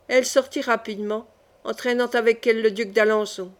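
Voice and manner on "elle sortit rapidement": plain reading voice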